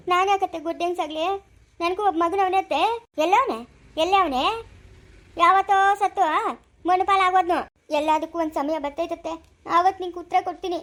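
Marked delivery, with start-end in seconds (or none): 3.2-7.6 loud, taunting yell; loud furious taunt; angry shout. Acted. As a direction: shout her down with scorn. Mother-in-law taunts about dead son.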